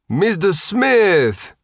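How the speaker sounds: high, drawn-out Sicilian greeting; delighted Sicilian host greeting a regular; Sicilian accent. A narrator's reading. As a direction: call his name warmly in a Sicilian accent. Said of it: "with a thick Sicilian accent".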